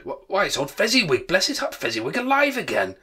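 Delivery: high, loud cry of delighted recognition; great excitement. A narrator's reading. Scrooge spotting his old master alive, greatly excited. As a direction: burst out, pitch leaping up. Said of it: "Scrooge cried in great excitement".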